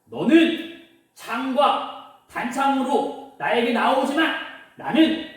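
measured, slow heroic declaration; bold, fearless David. Acted. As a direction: proclaim it without fear. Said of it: "당당하게 무서워하지 않고요".

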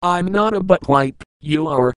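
ordinary delivery

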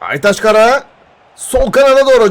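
ordinary talk

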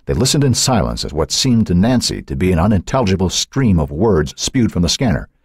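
ordinary delivery, nothing marked

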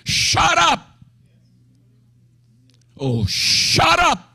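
high, sharp command to the demon; stern rebuke. Acted. Jesus rebuking the unclean spirit, high and commanding. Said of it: "Jesus rebuked him".

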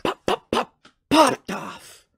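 squeaky, very high bursts; high popping noises. Pretend. Stoner telling how weed suddenly took off, high-pitched pops. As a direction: pop each word out in a tiny high voice.

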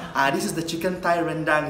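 ordinary talk

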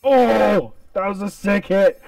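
high, loud hype over a kill; excited shout. Real, not acted. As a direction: yell it out, hyped. Big kill in game.

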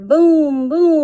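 loud booming; thunder boom. Pretend. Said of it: "makes thunder".

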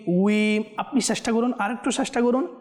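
usual speaking voice

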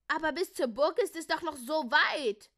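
loud, high-pitched moaning; whining complaint. A narrator's reading. The gnomes protest at the long walk, whining. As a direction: whine it out in protest. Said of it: "maunten die Heinzelmänner".

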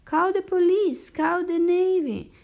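shrieking. A narrator's reading. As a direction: cry out for help in a high voice. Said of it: "shrieked the coach".

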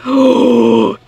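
quick startled intake of breath; sharp gasp. Real, not acted. Player gasps at the loop ahead, startled.

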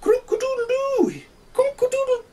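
very high, loud crow ending in a cough; crowing rooster. Acted. Cocky keeps crowing till he coughs, very high. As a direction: crow until the voice cracks into a cough.

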